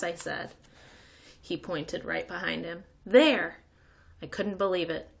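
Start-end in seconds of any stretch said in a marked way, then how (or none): 3.0-3.6 loud, eager; proud kid calling out. A narrator's reading. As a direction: announce it proudly.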